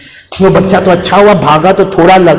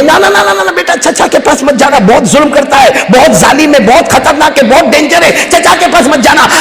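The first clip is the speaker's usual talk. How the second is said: much higher, frantic pleading, no no no; pleading mother; 7 semitones higher than usual. Acted. Mother begs her little son not to visit the cruel uncle, pleading high. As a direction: beg him not to go, voice pitched high.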